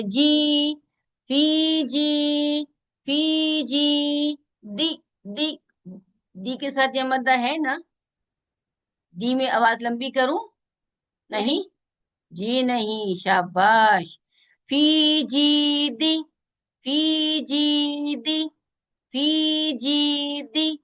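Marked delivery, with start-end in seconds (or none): 1.3-4.4 फीजी with the ई held two counts; long madd vowel. Shown as a demonstration. Teacher demonstrates the madd in फीजी, stretching the vowel. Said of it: "आगे या मद्दा". 14.7-20.8 फी and जी held long, दी kept short; madd length contrast. Shown as a demonstration. Teacher shows long फी जी against short दी, chanted. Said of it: "दी के साथ ये मद्दा नहीं".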